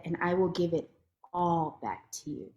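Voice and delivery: soft low voice sinking to a whisper on everything; voice of Allah. Retold or imitated. Allah telling her to be grateful, soft and hushed. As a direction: fade down to a tender hush. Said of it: "And he said".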